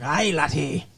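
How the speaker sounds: light mock Scottish brogue as Scrooge McDuck; Scottish accent. Host imitating Scrooge McDuck for his girlfriend, light Scottish accent. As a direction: do a quick, playful Scottish uncle. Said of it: "he had the, the accent".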